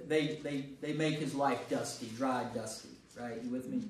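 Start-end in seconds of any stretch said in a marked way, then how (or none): none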